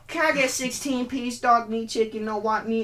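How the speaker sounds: a hint of a pushy customer ordering at the counter; mock customer voice. Rude customer ordering, quoted.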